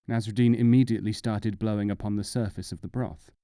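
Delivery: ordinary delivery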